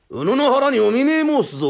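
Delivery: loud, high, theatrical menace; swordsman's threat. Acted. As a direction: declaim it like a period swordsman. 刀で芝居がかった脅し. (芝居がかった大声の脅し)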